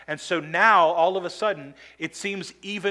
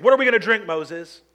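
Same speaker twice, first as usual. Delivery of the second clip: grumbling demand; 2 semitones higher, 7 dB louder than usual. Retold or imitated. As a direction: demand it of Moses, raising the voice.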